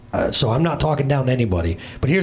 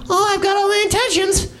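high, hammed-up airy excuse; mock well-meaning voice. Preacher parodies people who only intend to speak, high and hammed-up. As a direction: parody someone full of good intentions.

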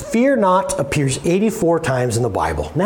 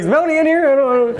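mock worried question; 7 semitones higher than usual. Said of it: "I was like".

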